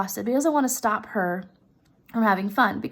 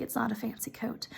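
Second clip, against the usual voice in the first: whispered aside; 13 dB quieter than usual. Real, not acted. Teacher confiding about the wife's coat, whispered. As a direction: whisper it, no voice at all.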